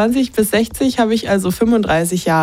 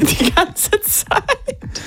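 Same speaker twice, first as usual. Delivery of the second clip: laughing; 10 semitones higher, 3 dB quieter, much slower than usual. Real, not acted. Laughing at the absent listener.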